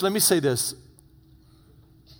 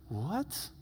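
very soft, rising question; hushed, incredulous; stunned disbelief; 14 dB quieter than usual. Acted. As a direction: barely get the question out, baffled. Stunned brothers hearing Joseph, acted.

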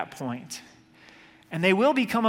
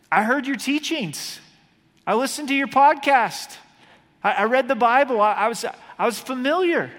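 higher, much louder frantic plea; desperate pleading; 7 semitones higher, 6 dB louder than usual. Retold or imitated. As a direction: beg to be let in, voice rising. The rejected pleading with Jesus.

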